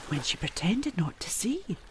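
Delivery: gasped whisper. Acted. Fearful recollection.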